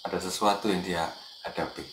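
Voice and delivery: soft, low whispered-down prayer; silent prayer. Retold or imitated. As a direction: pray inwardly, barely voiced. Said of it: "dalam hati langsung berdoa".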